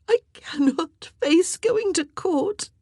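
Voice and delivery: slow, high weeping voice; distraught, tearful dread. A narrator's reading. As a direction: weep it out, dragging the words. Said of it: "Mary wept".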